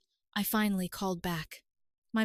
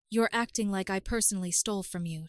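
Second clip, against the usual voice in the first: usual speaking voice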